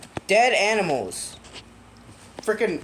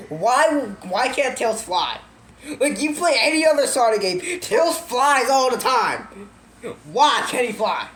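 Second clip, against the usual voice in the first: louder, indignant questioning; exasperated rant; 4 semitones higher, 3 dB louder than usual. Real, not acted. Storyteller challenges a plot hole, loudly exasperated. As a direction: protest it emphatically in disbelief.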